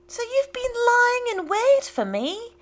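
high, loud, shocked outcry; cried out. A narrator's reading. George crying out at his father, high and loud. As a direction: burst out at the trap he's found. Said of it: "cried George".

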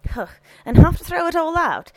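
loud, high housekeeper outburst; indignant retort. A narrator's reading. Housekeeper objecting to wasting the dinner, indignant.

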